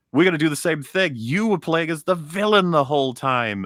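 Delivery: lightly voiced boastful game; mock game voice. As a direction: announce the twist like a proud showman. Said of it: "The Infinite was like".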